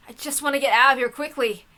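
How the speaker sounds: usual speaking voice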